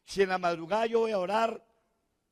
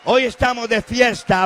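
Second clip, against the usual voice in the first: louder and higher, HOY pushed up; festive call repeated; 6 semitones higher, 14 dB louder than usual. Acted. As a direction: ramp the celebration higher.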